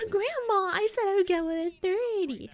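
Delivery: high, loud mock wail; hammed-up exasperated whine; whining protest. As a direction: wail at grandma like a pestered grandchild. Acted protest to nagging grandma.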